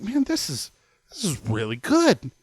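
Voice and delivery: high, fast delighted rave; a lightly voiced coworker raving about the pie; enthusiastic coworker. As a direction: rave about it like it's great. Engineer raves about pie.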